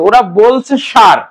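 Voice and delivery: সার with a slow retroflex sh; slow demo of সার, retroflex sh; retroflex sar. Shown as a demonstration. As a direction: draw out সার with a retroflex sh, slowly. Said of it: "উচ্চারণ করতেছে মূর্ধন্য".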